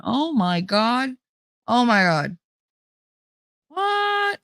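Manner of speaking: slow stunned gasps ending in a high squeaked what; drawn-out disbelief then a sudden high what; shocked reaction. Retold or imitated. Reading a friend's texts, stunned disbelief. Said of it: "I literally sit there going".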